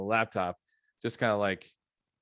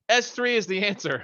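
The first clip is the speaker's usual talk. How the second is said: high, loud blurted-out answer; imagined shout; 10 semitones higher, 6 dB louder than usual. Retold or imitated. Son blurting the exam answer to dad, high and loud. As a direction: shout the answer across the room. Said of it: "if he had shouted out".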